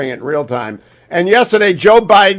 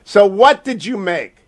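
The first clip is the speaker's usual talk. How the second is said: ordinary talk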